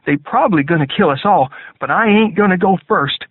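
high, loud Southern soldier; defiant soldier. A narrator's reading. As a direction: push it up high with grim defiance. Hugh voiced, defiant.

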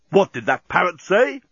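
startled captain. A narrator's reading. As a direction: demand it like a captain. Captain reacts to the intercom.

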